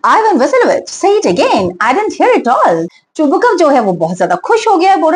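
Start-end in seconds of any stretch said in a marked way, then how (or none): 0.1-2.9 lightly hammed-up joyful father; joyfully. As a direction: burst out in delight at the news. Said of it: "चुबुकव joyfully".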